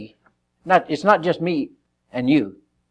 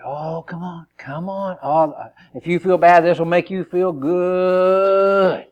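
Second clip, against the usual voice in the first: luring tempter; 5 semitones higher, slower than usual. Acted.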